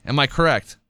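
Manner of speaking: ordinary delivery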